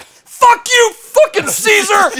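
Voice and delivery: very high, very loud screaming curse; a wild retold shout of rage, dragged out slowly; furious yell. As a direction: scream it while being hauled out the door. Being carried out of casino.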